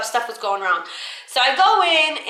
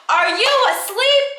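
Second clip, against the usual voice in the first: screaming boss; 11 semitones higher than usual. Retold or imitated. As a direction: scream at a worker to wake up. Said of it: "like, screaming".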